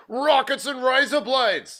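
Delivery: high, slow announcer shout of the show title; hyped show-name call. Acted. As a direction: belt out the title like a game-show host.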